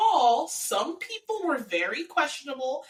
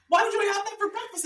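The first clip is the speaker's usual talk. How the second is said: high, quick imitated protest; mock baffled objection; 11 semitones higher, faster than usual. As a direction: fire off his objection high and fast. Voicing the boyfriend's baffled objection.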